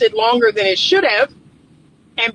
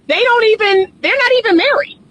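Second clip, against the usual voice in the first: indignant disbelief; 9 semitones higher, faster than usual. Real, not acted. Vlogger telling viewers the couple isn't married, high outraged disbelief. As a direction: rise up in scandalized surprise.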